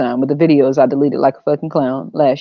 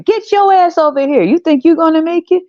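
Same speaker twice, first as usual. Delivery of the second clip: mock scream; 11 semitones higher, 3 dB louder than usual. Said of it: "you scream".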